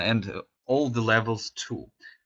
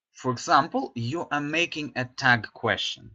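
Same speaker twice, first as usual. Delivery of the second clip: usual speaking voice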